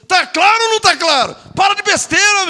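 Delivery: high-pitched, exasperated demand; exasperated scolding. Real, not acted. Preacher fed up with quibbles over a name, exasperated.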